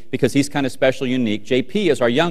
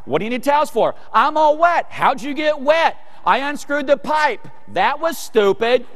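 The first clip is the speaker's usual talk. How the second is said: high-pitched hollered back-and-forth; mock shouted argument; 11 semitones higher than usual. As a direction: act out a bickering couple yelling between floors. Yelled exchange between floors, acted.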